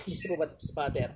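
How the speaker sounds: soft, breathy whispered formula; whispered incantation. Acted. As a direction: breathe the spell out under your breath. (pabulong na orasyon)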